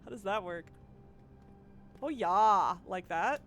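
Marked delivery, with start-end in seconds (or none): 2.0-2.8 loud, exaggerated Midwestern accent; hammed-up Midwestern mascot holler; Midwestern Kool-Aid Man. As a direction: shout it with broad Midwestern vowels. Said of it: "do the Midwestern Kool-Aid Man".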